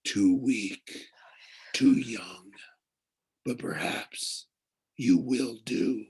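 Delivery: slow half-whispered voice from the abyss; cold ancient whisper. A narrator's reading. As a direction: weigh him up coldly, slowly. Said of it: "The voice felt ancient, cold, and heavy".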